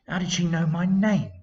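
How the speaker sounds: plain reading voice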